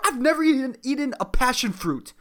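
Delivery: ordinary talk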